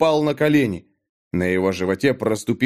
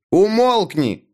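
high, sharp order to be silent; shouted command; 7 semitones higher, slower than usual. A narrator's reading. Natan silencing the babbling Mik, shouted. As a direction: cut the pleading off with a cry. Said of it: "крикнул Натан".

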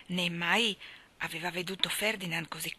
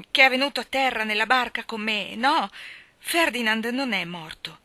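loud, raised, emphatic insistence; forceful denial; 4 semitones higher, 9 dB louder than usual. A narrator's reading. Mally insisting Ferdinand is not dead, in forceful denial. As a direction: deny it with full force. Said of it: "sbottò a dire con forza".

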